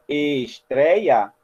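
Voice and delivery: ESTREIA slowly stretched into syllables; sílabas destacadas. Shown as a demonstration. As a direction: draw out each syllable of "estreia". Shows syllables of the word. (estreia bem devagar, sílaba por sílaba)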